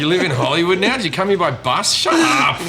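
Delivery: high, loud sarcastic scoffing; mocking disbelief. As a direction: scoff at the award winner, pitch climbing.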